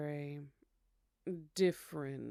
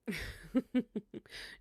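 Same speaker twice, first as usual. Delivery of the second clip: light laugh; 6 semitones higher than usual. Acted. [name] amused by guest.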